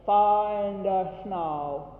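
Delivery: slow "Find us now." with jaw held still; jaw-still diction. Shown as a demonstration. Choir leader showing singers jaw-still diction, slowly. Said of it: "keeping your mouth still without your hand".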